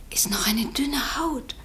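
fearful hush; whispered. Acted. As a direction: whisper it as if the floor might break. Lucy describes the hollow ground.

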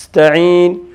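نستعين with a long held s; stretched seen. Shown as a demonstration. Teacher showing that seen can be extended, long s in نستعين. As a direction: draw out the hissing س in نستعين before finishing. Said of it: "إذن قابل للتمديد".

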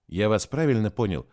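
plain reading voice

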